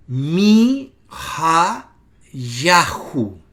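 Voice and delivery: MI, HA, YAHOO, cada parte muy lenta y separada; demostración silábica. Shown as a demonstration. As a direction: alarga MI, HA y YAHOO, marcando cada sílaba con fuerza. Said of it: "Noten el acento". (pronunciación didáctica, sílaba por sílaba)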